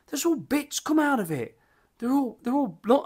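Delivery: a mock-anxious old dad fussing over lumps; imitating worried dad. Dad frets to his son about bits in beer, high, mock-worried voice.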